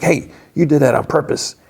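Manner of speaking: high-pitched, indignant mock yell; imitated mad accusation. Miguel steps forward after the foul, imitated angry accusation. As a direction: accuse him, voice jumping up, without full volume. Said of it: "Miguel's getting mad".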